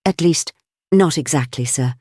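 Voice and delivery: ordinary delivery, nothing marked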